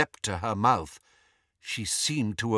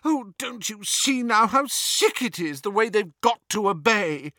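high, pleading dismay; distressed cry; 9 semitones higher than usual. A narrator's reading. Hermione appalled at the elf's servitude, distressed. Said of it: "Hermione cried".

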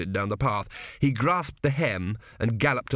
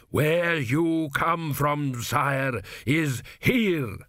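thundering; 4 semitones higher, much slower than usual. A narrator's reading. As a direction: thunder each word down. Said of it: "thundered Dios".

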